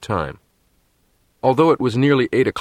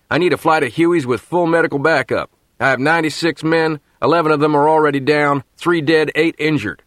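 urgent radio call; 7 semitones higher, 3 dB louder than usual. Acted. Ambushed captain requests rescue.

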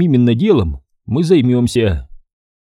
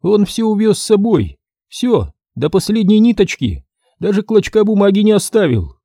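обиженный хозяин; 8 semitones higher than usual. Acted. Хозяин жалуется, высокий голос.

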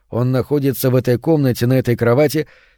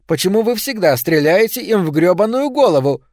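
exasperated outburst; 7 semitones higher than usual. A narrator's reading. Caldwell exasperated after the shot.

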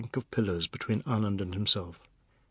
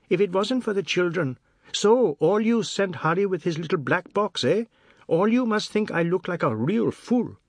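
higher and louder, nagging accusation; quarrelsome grievance; 6 semitones higher, 4 dB louder than usual. A narrator's reading. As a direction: needle her with loud complaints. Quarrel with wife.

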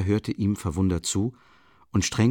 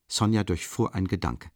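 ordinary delivery

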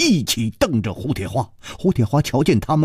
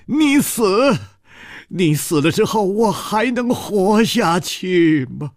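stamping in despair; 7 semitones higher than usual. Acted. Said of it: "跺着脚说".

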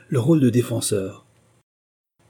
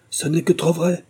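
higher-pitched cry of admission; rueful exclamation; 7 semitones higher than usual. A narrator's reading. Jenkinson admitting the sham wedding, rueful exclamation. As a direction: exclaim it, mildly dramatised. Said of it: "s'écria Jenkinson".

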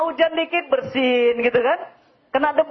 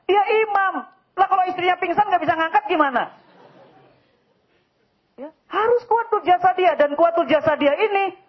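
raised preaching voice, higher; preaching emphatically; 8 semitones higher, 4 dB louder than usual. Acted. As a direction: drive the point home to the men. Preacher raising voice on point. (ceramah berapi-api, suara meninggi)

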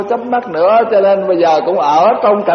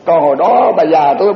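ordinary delivery

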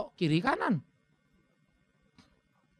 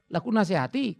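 normal talking voice

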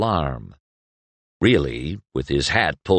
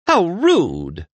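mock offended; 11 semitones higher, 4 dB louder than usual. A narrator's reading.

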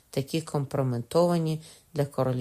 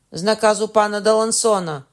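loud confession; 7 semitones higher, 9 dB louder than usual. A narrator's reading. Forced confession shouted.

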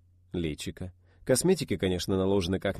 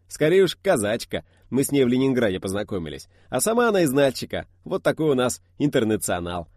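jovial driver voice; 5 semitones higher, 8 dB louder than usual. Acted. Laughing driver answers.

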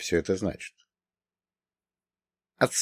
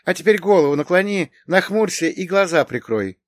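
high, brisk, louder orders; bossy high-voiced woman; commanding; 9 semitones higher, 5 dB louder than usual. A narrator's reading. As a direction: rattle off instructions in a high voice. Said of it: "продолжила командовать".

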